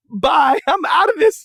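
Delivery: high, slow laughing shout; laughing farewell. Retold or imitated. Leaving the party for good, high laughing shout. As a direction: crow it out with glee.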